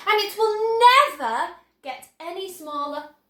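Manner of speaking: high, loud eager showman; can barely contain the pride; thrilled inventor explaining; excited boasting. Acted.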